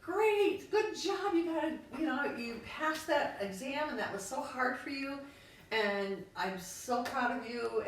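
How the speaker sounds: high, loud gushing praise, slowing at the end; overly cheery parent praise. Retold or imitated. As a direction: gush like a proud parent before the catch. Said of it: "it's like".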